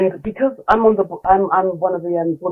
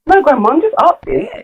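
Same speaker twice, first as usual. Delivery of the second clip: put-on innocent child; 9 semitones higher than usual. Grandson voiced.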